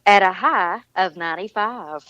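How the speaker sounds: a Southern woman copies the British weather line; Southern accent. Shown as a demonstration. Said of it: "And a what?".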